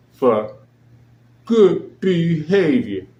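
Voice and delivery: drawn-out, slow sarcastic echo of the excuse; mocking quote. Host sneering at the release reason, slow and mocking. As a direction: stretch it out like a flimsy official excuse.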